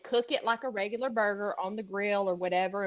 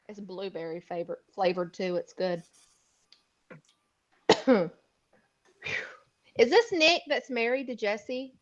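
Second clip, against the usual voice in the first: normal talking voice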